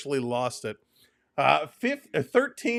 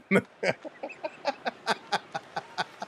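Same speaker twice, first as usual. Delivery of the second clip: airy breathless laugh; 6 semitones higher, 5 dB quieter than usual. Real, not acted. Laughing at chat.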